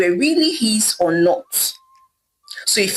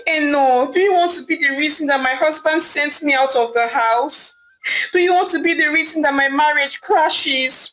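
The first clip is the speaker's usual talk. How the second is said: higher, desperate begging; pleading and begging; 6 semitones higher than usual. Acted. Wife begging her sister to help, pleading high. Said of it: "pleading and begging with her sister".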